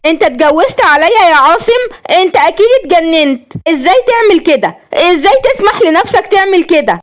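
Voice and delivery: high, raging accusation; betrayed wife screaming at husband; furious wife. A narrator's reading. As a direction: shriek at the husband who remarried. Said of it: "عشان تكمل بغضب".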